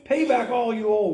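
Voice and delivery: high, loud harsh demand. A narrator's reading. Servant throttles his fellow servant, loud angry demand. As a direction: bark it at the debtor. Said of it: "he demanded".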